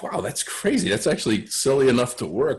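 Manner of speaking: high, bright-eyed gush breaking into a laugh; excited fiance. Retold or imitated. Fiance reacts to his book title, excited and laughing. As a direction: gasp it out, delighted and amused.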